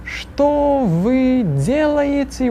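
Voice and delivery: very slow, word-by-word model question; slow repeat-after-me. Shown as a demonstration. Teacher models the question slowly for students to repeat. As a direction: draw out every syllable for the class. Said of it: "this painfully slowly".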